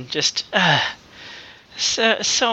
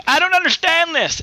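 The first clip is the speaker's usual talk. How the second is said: mock yell; 12 semitones higher, slower than usual.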